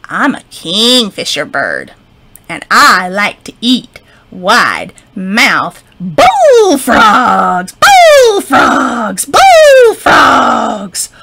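slow, loud, looming bird voice; menacing kingfisher. Acted. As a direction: savour the threat slowly. Kingfisher threatens frog.

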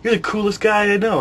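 a hint of fifth graders fawning; kids praising. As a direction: gush like starstruck fifth graders. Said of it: "They're all".

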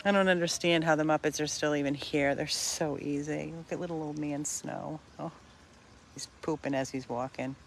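usual speaking voice

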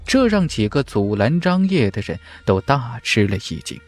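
usual speaking voice

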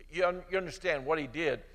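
ordinary talk

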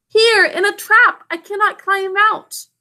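high, loud call from the trap; crying out. Acted. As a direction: call up for help. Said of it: "cried Mowgli".

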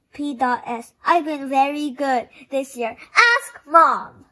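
put-on loud, eager child voice; excited child reading letter. A narrator's reading. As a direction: read the letter bursting with hope. Child reads letter to Santa.

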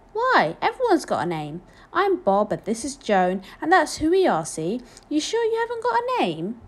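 lively Bob-like questioning, pitch leaping; animated, incredulous old man; puzzled old cobbler. A narrator's reading. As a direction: question a child with kindly disbelief. Voices Bob questioning the boy.

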